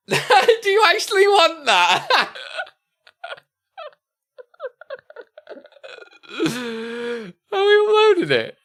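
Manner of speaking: high, slow words broken by laughter; laughing disbelief. Real, not acted. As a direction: laugh through the questions in disbelief.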